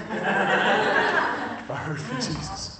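slow, drawn out, with a laugh; awed repeat. Retold or imitated. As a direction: savour it, letting each word stretch out. Quote repeated slowly, in awe.

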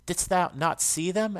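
ordinary delivery

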